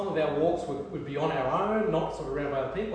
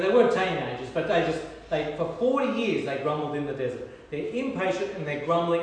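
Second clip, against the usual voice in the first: ordinary talk, nothing marked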